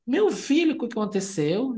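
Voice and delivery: high panicked cry to the hurt son; desperate mother. Retold or imitated. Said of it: "A mãe no desespero". (mãe desesperada, voz bem aguda)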